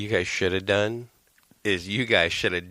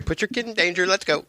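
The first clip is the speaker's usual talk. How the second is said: high, sing-song mocking voice; mock-cheerful parody of husband; mock eager dad; 9 semitones higher than usual. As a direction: mimic the reckless dad, pitched way up.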